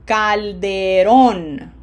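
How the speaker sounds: Calderón slow and loud, weight on rón; emphatic teacher demo of Calderón; final-syllable stress. Shown as a demonstration. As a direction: stretch Calderón and punch its last syllable. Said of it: "P E one".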